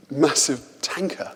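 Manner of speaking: normal talking voice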